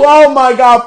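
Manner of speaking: usual speaking voice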